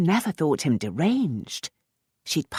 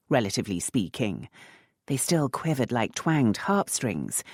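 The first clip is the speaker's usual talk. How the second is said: ordinary delivery, nothing marked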